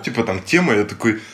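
usual speaking voice

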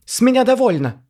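very high, loud squeal; shriek. A narrator's reading. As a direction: squeal it in outrage. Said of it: "взвизгнул Пётр".

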